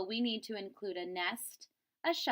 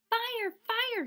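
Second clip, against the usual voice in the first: high, loud warning shout of fire; alarmed cry; 13 semitones higher, 6 dB louder than usual. Acted. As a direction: call out fire to scare the birds. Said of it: "he cried".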